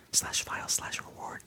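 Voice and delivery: hushed whisper. Pretend. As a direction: whisper the end quickly. Whispered aside in ad.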